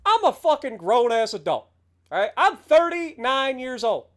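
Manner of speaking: ordinary delivery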